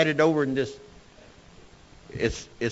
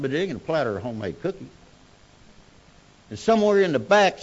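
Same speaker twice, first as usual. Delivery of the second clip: usual speaking voice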